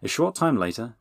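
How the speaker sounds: usual speaking voice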